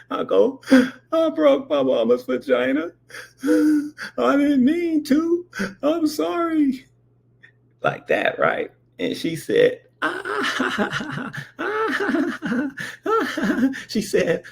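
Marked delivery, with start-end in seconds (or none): 1.1-6.8 put-on high, slow tearful boy; crying child. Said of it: "And I started crying". 10.0-13.7 high cackling auntie; high laughing. Retold or imitated.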